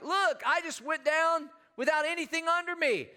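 high-pitched, excited kid imitation; excited young son. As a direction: mimic a thrilled boy calling out, high.

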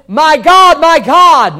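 high, loud preacher's cry; anguished appeal at full voice; cry of abandonment. Acted. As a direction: call out to God as one forsaken. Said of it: "This is a cry of abandonment".

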